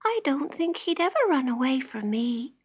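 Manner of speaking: put-on high, earnest child's voice; little girl voice. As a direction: sound like a small girl in love with her pet. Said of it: "said Jenny".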